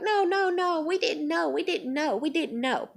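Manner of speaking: high-starting mock protest, easing down; mocking denial. Mimicking coworkers' denial, mocking high voice. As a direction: mimic their repeated excuse.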